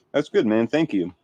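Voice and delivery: talking with a mouth full of food; chewing between words. Real, not acted. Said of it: "That's the only reason I'm stuffing my face".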